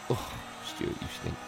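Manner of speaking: breathy aside, all breath and no voice; mock whisper. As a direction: whisper the joke off-mic to Stuart. Teasing Stuart at the mic.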